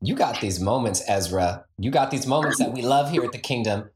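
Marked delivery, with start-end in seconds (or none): none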